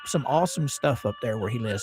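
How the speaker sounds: ordinary talk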